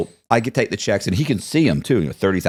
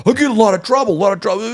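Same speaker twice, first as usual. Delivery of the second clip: much higher, louder whiny mock excuse; imitating Eric; 13 semitones higher, 5 dB louder, faster than usual. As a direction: whine it like a nervous friend.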